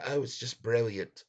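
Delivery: lightly imitating how the English talk; British accent. Streamer mocks the English in a light British accent. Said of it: "where they all talk like this".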